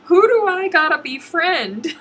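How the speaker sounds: high, loud, laughing; high, loud laughing plea; laughing mock plea. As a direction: laugh through a joking question. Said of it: "I was like".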